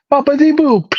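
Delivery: put-on over-the-top Italian accent; mock Italian accent. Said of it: "Italian accent".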